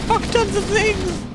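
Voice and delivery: loud high gleeful yell; gleeful shout. Real, not acted. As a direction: shout it with glee, slowly.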